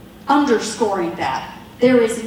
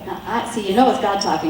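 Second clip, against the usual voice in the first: ordinary delivery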